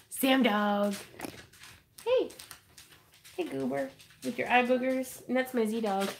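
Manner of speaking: usual speaking voice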